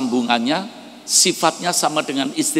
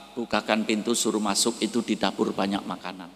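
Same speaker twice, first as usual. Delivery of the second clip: quiet plea; 4 semitones lower, 8 dB quieter than usual. Acted. Wife quietly urges husband.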